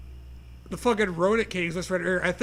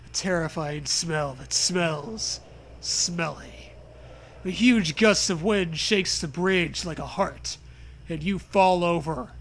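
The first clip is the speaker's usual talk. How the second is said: ordinary talk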